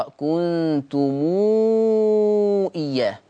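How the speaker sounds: كنتم drawn out as "kuntumuuu" before إياه; Warsh silat al-mim. Shown as a demonstration. Teacher showing Warsh's reading, كنتم lengthened into a long "muuu". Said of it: "قرأ ورش بصلة ميم الجمع".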